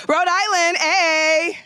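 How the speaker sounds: high, loud call to Rhode Island; excited shout-out. Real, not acted. Host calling out Rhode Island folks, excited. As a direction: cheer the state on.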